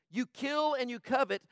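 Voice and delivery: loud, slow, high preacher's reading; fiery sermon reading of scripture; preaching at full force. Acted. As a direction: thunder the scripture at them.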